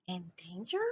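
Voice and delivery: softly wondering to herself; inner thought. A narrator's reading. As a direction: say it under your breath. Coraline thinks to herself.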